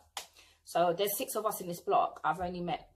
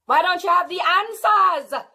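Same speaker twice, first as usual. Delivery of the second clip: retold shout; 9 semitones higher, 9 dB louder, slower than usual. Retold confrontation with neighbour.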